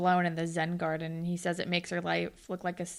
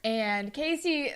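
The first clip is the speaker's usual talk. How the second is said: ordinary talk, nothing marked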